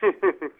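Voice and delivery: short low chuckle; real dry little laugh; scornful chuckle. Real, not acted. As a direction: chuckle briefly before answering. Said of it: "मुझे तो हंसी आ रही है".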